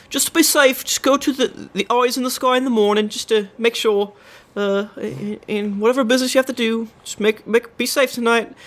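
high, hurried apologetic guard; suddenly meek guard calling to his mate; flustered guard. Acted. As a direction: backpedal fast like a caught-out guard. Guard fawning farewell.

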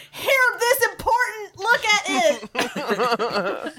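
high-pitched, garbled urgent babble; frantic mock pleading in broken phrases; jibber-jabbering. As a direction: jabber it at someone, tumbling into laughter. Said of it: "is jibber jabbering".

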